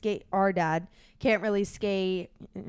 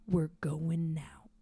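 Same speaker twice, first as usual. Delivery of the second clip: slow, hushed whisper as if sneaking out; 5 semitones lower, 9 dB quieter, much slower than usual. Acted. Dad telling his son's surf story, slow and whispered. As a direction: breathe it like the moment before a big set. Dad narrating tense paddle out.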